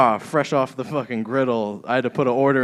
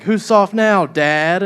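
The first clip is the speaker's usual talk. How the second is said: high, loud gloating daughter; hammed-up revenge taunt; mock taunt; 7 semitones higher, 8 dB louder, much slower than usual. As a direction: throw it back at her dad in triumph. Daughter's imagined taunt to father.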